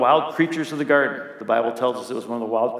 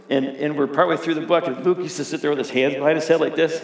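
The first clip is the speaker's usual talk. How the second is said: ordinary talk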